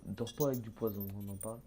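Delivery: usual speaking voice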